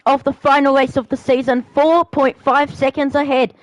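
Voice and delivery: steadier excited play-by-play; race commentary. Acted. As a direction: keep calling the laps with energy.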